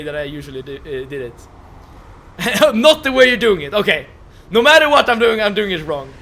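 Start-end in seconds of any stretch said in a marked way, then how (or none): none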